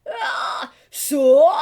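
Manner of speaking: gritty fry scream; vocal fry scream. Shown as a demonstration. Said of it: "get the fry going".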